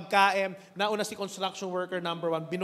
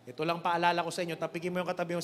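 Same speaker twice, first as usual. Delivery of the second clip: ordinary delivery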